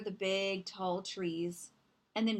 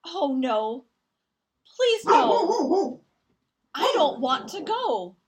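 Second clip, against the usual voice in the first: desperate pleading; 6 semitones higher, 9 dB louder, slower than usual. Acted. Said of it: "thought Little Seed".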